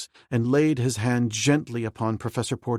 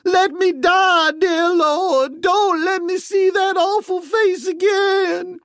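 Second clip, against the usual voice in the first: very high, slow wailing cry; high slow sobbing wail; wailing maid; 7 dB louder, slower than usual. A narrator's reading. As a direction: wail in terror with eyes shut. Maid's voice held throughout.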